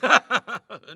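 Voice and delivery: loud teasing laughter; mocking laugh. A narrator's reading. Friends teasing Barnes about fetching water, mocking laugh. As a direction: laugh at the nervous one.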